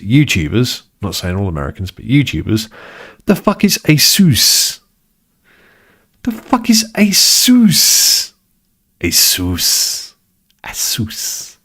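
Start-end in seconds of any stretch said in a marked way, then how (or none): 3.3-8.3 high, slow mock American "Asus" as ah-SOOS; mock-outraged American ah-SOOS. Vlogger imitates American YouTubers saying ah-SOOS, high and slow. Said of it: "Americans... YouTubers". 9.0-11.6 "Asus" said ah-SOOS, rhyming with masseuse; American Asus. Shown as a demonstration. Vlogger demonstrates the ah-SOOS that rhymes with masseuse. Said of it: "Asus rhymes with masseuse".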